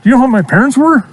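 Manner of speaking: high, huffy diva voice for the horse; horse's indignant voice. Retold or imitated. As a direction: voice the horse as an offended celebrity. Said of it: "He's like".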